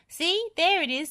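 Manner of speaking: high, nervous pointing out; scared Lofty. A narrator's reading. Lofty shows Wendy the dragon, frightened. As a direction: spot the danger, jumpy.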